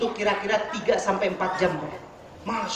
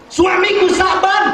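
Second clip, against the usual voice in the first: high, loud, drawn-out cry; anguished outcry; 7 semitones higher, 6 dB louder than usual. Acted. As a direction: cry out the name as a grieving widow. Widow breaks news of husband's death.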